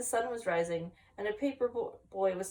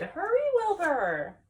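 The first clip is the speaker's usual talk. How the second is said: high, slow calling shout; 7 semitones higher, slower than usual. A narrator's reading. Friend shouting to Wilbur to hurry. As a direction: draw it out as a high call. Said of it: "his friend shouted".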